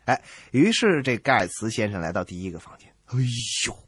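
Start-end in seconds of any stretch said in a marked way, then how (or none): none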